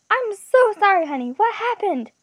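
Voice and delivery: high, loud, distraught apology; sobbing. A narrator's reading. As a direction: pour it out in an acted tearful wail. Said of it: "the woman sobbed".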